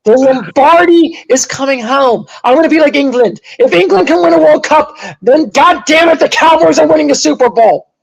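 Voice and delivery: high, loud proclamation; impassioned fan rant. Real, not acted. Cowboys fan promising co-hosts a title, impassioned rant. Fan rants his team will win.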